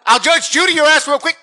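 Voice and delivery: high, loud hammed-up yell; mock shouted threat. Husband shouts down his interrupting wife, mock threat. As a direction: roar it like a tv judge gone wild. Mocking partner who interrupts.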